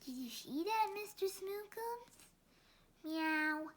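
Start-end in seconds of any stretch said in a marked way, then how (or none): none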